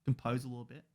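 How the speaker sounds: reining himself in after the outburst; hushed, quick calm-down aside; composing himself. Real, not acted. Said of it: "if I wanna just pull it back a little bit".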